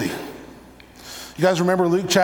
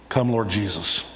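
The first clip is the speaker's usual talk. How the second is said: quiet prayer; 8 semitones lower, 4 dB quieter than usual. Real, not acted. Prayerful plea ending thought.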